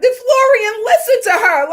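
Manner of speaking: loud, high, crying-out question; excited outcry. Retold or imitated. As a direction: cry it out in disbelief. Retold audience outcry.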